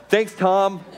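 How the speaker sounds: acted sarcastic appreciation; sarcastic thanks. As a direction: thank the heckler through your irritation. Mock thanks to heckling fan.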